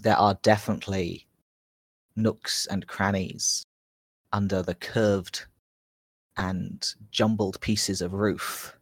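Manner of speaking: slow measured scene setting with pauses; game master narration. A narrator's reading. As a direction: draw them into the ruined temple. GM recaps and sets the scene.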